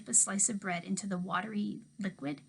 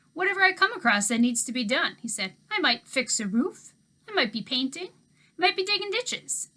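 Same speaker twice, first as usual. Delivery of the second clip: put-on easygoing old handyman; 6 semitones higher, 7 dB louder than usual. A narrator's reading. Old handyman teasing the girl about her dog, louder and folksy.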